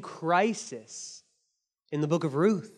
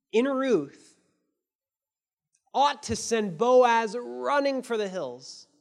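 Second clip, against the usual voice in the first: normal talking voice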